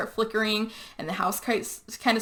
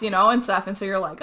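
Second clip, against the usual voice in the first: usual speaking voice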